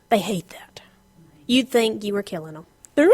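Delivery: ordinary delivery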